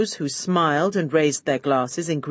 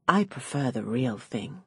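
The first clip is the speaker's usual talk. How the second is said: hushed, lower aside; loud whisper; 4 semitones lower, 7 dB quieter than usual. A narrator's reading. To the waiter offering champagne, a loud whisper. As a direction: murmur it confidentially, half aloud. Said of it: "I assured him in a loud whisper".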